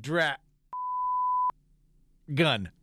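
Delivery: "Dra-" cut off, long silence, then "gun"; long mid-word pause. Retold or imitated. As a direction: split the word, holding back "gun". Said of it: "he gave a long, silent pause".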